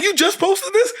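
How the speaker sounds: very high incredulous question; mock disbelief. Wife questioning husband about the new post, high mock disbelief. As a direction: imitate her astonished questioning.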